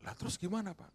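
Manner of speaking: astonished question. Retold or imitated. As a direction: gasp it out high and shocked. Businessman asks, retold.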